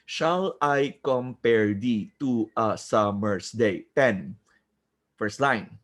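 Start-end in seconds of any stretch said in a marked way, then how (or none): 0.1-3.9 very slow, syllable by syllable; counting syllables. Shown as a demonstration. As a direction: recite it slowly so each syllable can be counted. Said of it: "Count with me".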